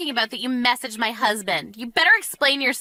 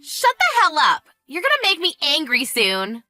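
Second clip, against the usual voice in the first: high, annoyed outburst in a quarrel; irritado; 5 semitones higher, 3 dB louder than usual. Acted. Sister-in-law snaps back in quarrel.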